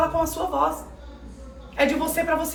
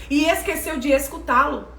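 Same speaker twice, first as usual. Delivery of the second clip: ordinary talk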